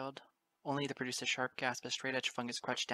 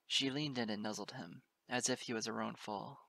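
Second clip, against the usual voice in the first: plain reading voice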